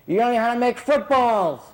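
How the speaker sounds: high, loud comic telling-off; retold scolding. Guru teasing his cook over samosas, loud mock scolding. Retelling guru's scolding, laughter follows.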